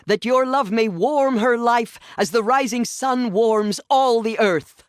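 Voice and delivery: high, loud ceremonial blessing; ritual proclamation. Acted. Matriarch blessing the child before the tribe, high loud proclamation. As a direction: proclaim it over the whole gathering.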